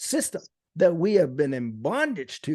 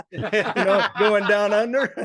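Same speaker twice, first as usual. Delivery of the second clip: laughing through a cheeky joke; amused laughing tease; laughing pun; 5 semitones higher, 5 dB louder than usual. Real, not acted. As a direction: crack the joke while chuckling, a bit louder. Punning on Australia, laughing.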